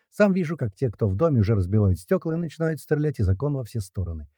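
usual speaking voice

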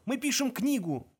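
high, drawn-out desperate plea; imploring wail of protest; pleading. A narrator's reading. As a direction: beg with a flimsy excuse, slowly and high. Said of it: "взмолился Форд".

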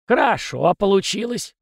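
put-on high, beaming old ghost. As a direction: glow with pride. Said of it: "просиял Василий". (сияя от гордости)

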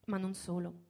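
usual speaking voice

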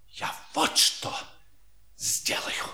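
unvoiced scheming whisper. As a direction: hush it like a secret plan. Plotting aside before public appeal.

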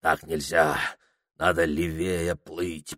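low, soft thinking aloud; quiet inner thought. Acted. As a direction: mull it over under your breath. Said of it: "подумал Ибрагим". (тихая мысль про себя)

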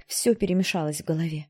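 ordinary delivery, nothing marked

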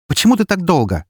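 high, loud snapped demand; angry bark. A narrator's reading. Эри to the narrator after waiting, an angry bark. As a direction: bark it indignantly, pitched well up. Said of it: "рявкнула Эри".

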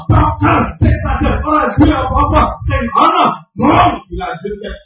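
loud, rousing exhortation; forceful sermon in punched phrases; preaching at full force. Real, not acted. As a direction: drive each phrase out loud.

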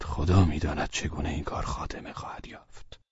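anxious whisper, barely voiced; whispering to himself. Velchaninov alone in his room, fearful, whispering to himself. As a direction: breathe the worry out under your breath.

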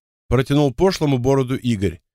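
ordinary delivery, nothing marked

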